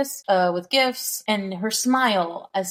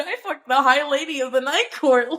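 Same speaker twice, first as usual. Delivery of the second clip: higher-pitched dude boast; mock bragging; 5 semitones higher, 3 dB louder than usual. Host imitating a book character bragging, mock boast. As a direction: brag to your buddy, hammed-up.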